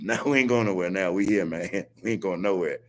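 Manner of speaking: chuckling through the words; laughing defiance. Real, not acted. Host laughing at the officials he just mocked. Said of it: "I'm laughing, but I'm serious".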